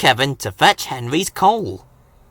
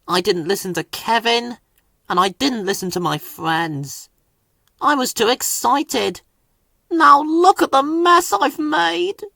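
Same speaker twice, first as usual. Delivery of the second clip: sad; 8 semitones higher than usual. A narrator's reading. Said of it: "he puffed sadly".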